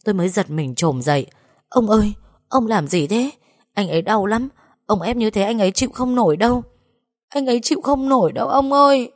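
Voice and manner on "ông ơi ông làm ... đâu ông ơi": high, distressed begging that climbs to a wail; frantic pleading. A narrator's reading. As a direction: beg him to stop, voice rising in panic. Frantic plea to stop the old man. (van xin hoảng hốt, giọng cao dần)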